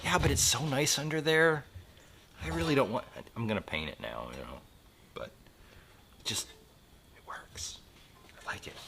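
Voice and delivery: trailing off softly; dropping to a murmur; quiet. Real, not acted.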